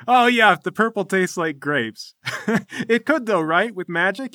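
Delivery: loud, high, laughing enthusiasm; big chortling meathead agreement; boisterous echo. Acted. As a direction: echo the wizard with noisy glee. Club man gleefully echoes the wizard.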